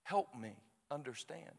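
soft, hushed plea to God; quiet retold prayer. Praying alone to God at night, quietly pleading.

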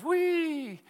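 high squeaky mouse cry; a tiny mouse sliding down, wee; high mouse squeal of delight. Acted. Second mouse in joke.

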